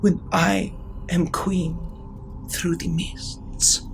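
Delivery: put-on high, soft child voice, fading to a breath; a sweet daughter saying goodbye before she turns; little girl's farewell.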